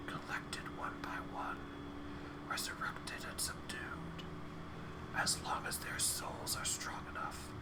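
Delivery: soft whispering chorus of skulls. Acted. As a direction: breathe every word as a whisper. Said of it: "their whispers blending together in a chorus".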